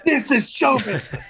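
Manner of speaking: high, pompous, laughing through it; laughing showman catchphrase. Retold or imitated. Comic repeating his catchphrase, cracking up as he says it. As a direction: ham up the showbiz line.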